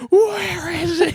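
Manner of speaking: high, loud, drawn-out demand; imitated enraged mom. Retold enraged mom at the door.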